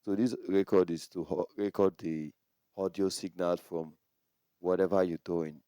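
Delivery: barely coming through the mic; record level turned down. Shown as a demonstration. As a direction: voice sunk down in the recording. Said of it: "If you turn down the record".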